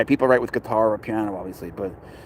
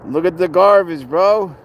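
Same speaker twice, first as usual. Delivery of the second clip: playful excitement; 6 semitones higher, 4 dB louder than usual. Pretend. Showing garbage with mock delight.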